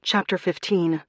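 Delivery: ordinary delivery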